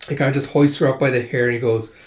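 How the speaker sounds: usual speaking voice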